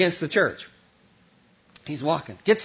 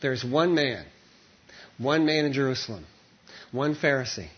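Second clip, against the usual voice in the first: ordinary delivery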